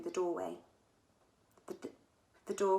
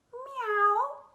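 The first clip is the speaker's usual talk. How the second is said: high, loud, drawn-out meow; a grumpy cat in a spacesuit; 8 dB louder than usual. A narrator's reading. Cat sound interrupting countdown.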